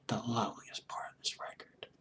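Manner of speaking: barely-there reverent whisper; whispered admiration. Real, not acted. Music fan savouring a favourite song, awed whisper. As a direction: whisper it in awe, very softly.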